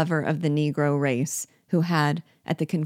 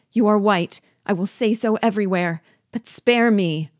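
raised, urgent begging; pleading for life; 4 semitones higher, 6 dB louder than usual. A narrator's reading. Unfortunate man suing for mercy, desperately pleading. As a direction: plead loudly with the voice pitched up.

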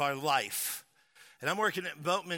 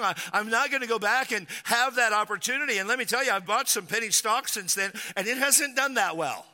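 raised, loud, building sermon voice; preaching at full force; 7 semitones higher, 4 dB louder than usual. Real, not acted. Pastor to congregation, preaching at full force. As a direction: drive it home to the congregation.

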